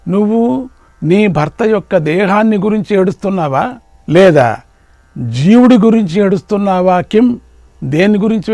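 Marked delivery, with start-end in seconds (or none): none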